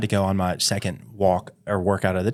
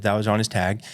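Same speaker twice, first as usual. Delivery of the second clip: normal talking voice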